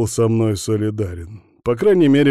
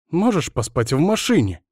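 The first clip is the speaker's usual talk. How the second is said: high-pitched female indignation; indignant; 7 semitones higher than usual. A narrator's reading. Woman sending Bogdan to the car, indignant. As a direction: protest in a raised, high voice. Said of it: "возмутилась она".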